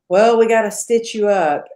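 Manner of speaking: a hint of a brisk ER doctor; mock doctor voice. ER doctor telling injured mom, lightly voiced brisk.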